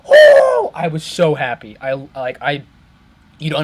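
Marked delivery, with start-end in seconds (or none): 0.1-0.7 high, loud squeal of joy; delighted squeal. Retold or imitated. First sight of the monster in battle, joyful squeal. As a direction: shriek it out like you just met a puppy. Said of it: "I'm like".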